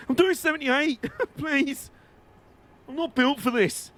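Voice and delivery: high-pitched, put-upon talking train; straining train voice. Pretend. Streamer voicing his overworked train, high and pleading. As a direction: voice a struggling train begging for mercy. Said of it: "It's like".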